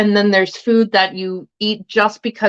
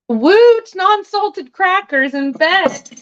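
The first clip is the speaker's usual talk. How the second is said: mock cheer; 10 semitones higher, much slower than usual. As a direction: cheer it up with playful irony. Mock cheer over plain crackers.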